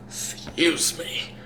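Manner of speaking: a breathy, slow whisper under his breath; barely voiced, slow apology; whispered excuse me. Real, not acted. Vlogger excusing himself on camera after a burp, whispered.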